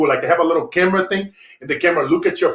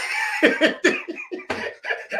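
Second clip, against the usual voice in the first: high laugh; 5 dB quieter than usual. Real, not acted. Laughing at the story.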